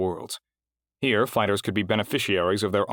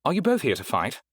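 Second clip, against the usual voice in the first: high, smooth clerk voice; crisp, courteous registrar; polished receptionist; 6 semitones higher than usual. A narrator's reading. Heidi voiced in narration.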